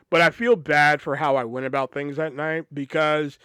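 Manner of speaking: usual speaking voice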